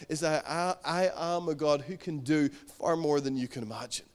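usual speaking voice

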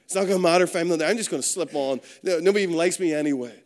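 fast, rushed self-talk, brushing it off; self-pitying mutter. Retold or imitated. Preacher voicing a churchgoer talking themselves out of coming, rushed. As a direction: rattle off the excuses quickly. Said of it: "we think like that".

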